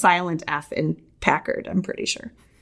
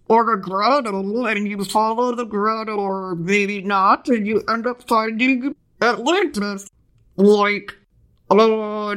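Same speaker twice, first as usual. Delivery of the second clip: underwater bubble voice; 3 semitones higher than usual. Acted. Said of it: "I should do the underwater bubble voice".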